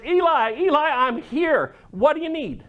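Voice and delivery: put-on a young boy rushing in, eager to help; keen young Samuel answering the call.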